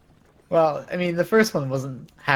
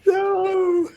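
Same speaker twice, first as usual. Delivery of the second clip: mock wailing protest. Player wails over twist ending.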